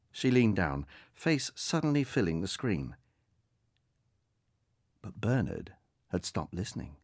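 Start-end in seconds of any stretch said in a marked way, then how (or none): none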